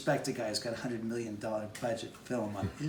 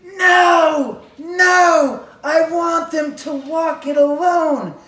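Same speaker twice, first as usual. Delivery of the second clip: much higher and louder, slow insistent shout; Quentin shouting; 15 dB louder, much slower than usual. Retold or imitated. Director yelling down the driveway to stop the walk, loud slow shout. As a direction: bellow it across the driveway like the director. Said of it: "you hear this voice go".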